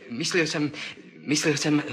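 normal talking voice

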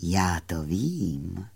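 low, soft, slow murmur of the moon; quiet voice. Acted. Moon comforts the sad fairy in a low soft murmur. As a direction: murmur it gently and slowly. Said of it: "zaševelil měsíc tichým hlasem".

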